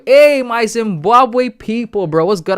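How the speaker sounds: big up the Zimbabwe viewers; excited shout-out. Real, not acted. Streamer shouts out his Zimbabwe viewers, hyped.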